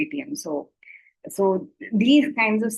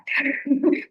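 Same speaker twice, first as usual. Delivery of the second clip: a quick amused laugh; short laugh; 7 semitones higher than usual. Real, not acted. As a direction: laugh it off lightly. Laughs at scam caller.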